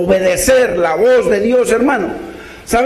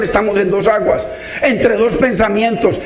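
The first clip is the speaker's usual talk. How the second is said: normal talking voice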